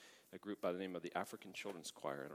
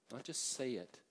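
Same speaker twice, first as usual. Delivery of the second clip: normal talking voice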